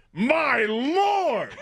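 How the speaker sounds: high, drawn-out exclamation of alarm; imitated yell. Dad grabbing the wheel from learner driver, high mock yell. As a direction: imitate a panicked dad yelling. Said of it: "and yells".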